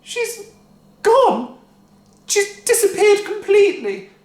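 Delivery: high, loud cry. A narrator's reading. Wife crying out that Grandma is gone. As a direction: cry out in shock. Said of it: "cried Missus Cranky".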